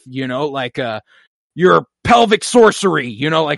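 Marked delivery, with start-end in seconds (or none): 1.5-3.1 lightly imitated heroine, higher and louder; a louder, raised-pitch quote in character; Gamora impression. Gamora says a funny line, lightly imitated in earnest. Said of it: "she's saying that 'cause that's what Gamora would say".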